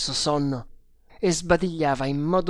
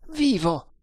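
high, sudden cry; astonished exclamation; 7 semitones higher than usual. A narrator's reading. Guard tears the gag off the prisoner, exclaiming. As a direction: exclaim it in one startled burst. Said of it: "esclamò".

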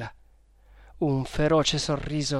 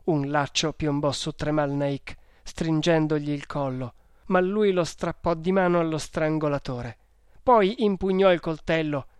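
ordinary delivery, nothing marked

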